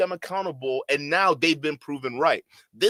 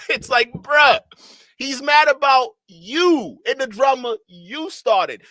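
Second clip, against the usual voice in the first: louder and higher, fed up; exasperated outburst; 8 semitones higher, 8 dB louder, slower than usual. Real, not acted. Commentator venting at Myron, loud exasperated. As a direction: blurt it out, exasperated and slow.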